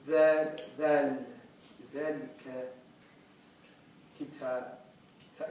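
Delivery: halting, word by word with long gaps; painfully slow first attempts at reading; slow beginner reading. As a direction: sound out each word like a new reader. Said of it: "It took me very slow".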